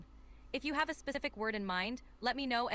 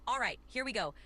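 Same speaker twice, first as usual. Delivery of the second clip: normal talking voice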